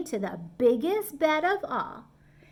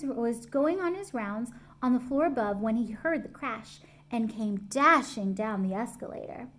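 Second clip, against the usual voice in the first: plain reading voice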